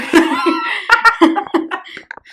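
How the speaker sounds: loud, high laughing fit; burst of laughter. Real, not acted. Laughing at lawless country joke.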